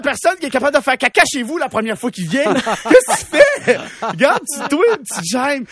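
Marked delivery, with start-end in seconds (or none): 2.5-5.6 high, loud exasperated scolding with a laugh; mock yell at a shameless stranger. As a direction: shriek it at the rude guy like a fed-up parent. Scolding imagined rude person.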